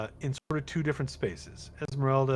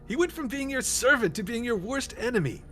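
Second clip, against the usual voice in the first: Esmeralda's disbelief; 11 semitones higher than usual. Retold or imitated. GM voicing Esmeralda scolding the party, incredulous and high. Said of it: "Esmeralda's like".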